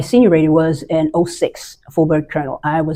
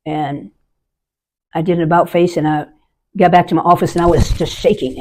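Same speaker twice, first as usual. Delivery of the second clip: usual speaking voice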